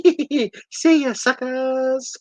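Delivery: high cartoon giggle and goodbye; hammed-up high-pitched farewell taunt; mock gleeful taunt. Hero taunts while floating away.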